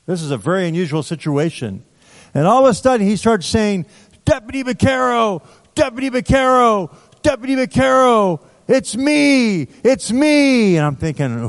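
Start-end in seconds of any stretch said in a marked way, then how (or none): 4.3-10.8 high, eager calls of his name; a former inmate hailing his deputy, high and eager; excited calling out. Retold or imitated. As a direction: call out like you're overjoyed to find him.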